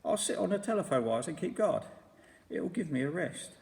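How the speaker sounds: a wounded little complaint; roly-poly bird voice. A narrator's reading. Roly-poly bird scolding Mugglewump, mildly hurt.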